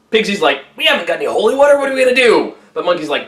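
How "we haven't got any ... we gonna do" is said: rapid, rushed worried gabble; flustered Pigsy. Acted. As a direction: blurt it out fast in a panic. Pigsy panicking about holy water.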